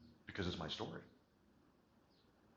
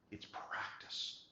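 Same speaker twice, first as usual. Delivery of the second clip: very slow, softer whisper; 6 dB quieter, much slower than usual. Shown as a demonstration. Coach whispering that skill comes from practice. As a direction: draw it out in a hush. Repeated whispered drill word.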